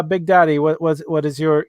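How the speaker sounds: usual speaking voice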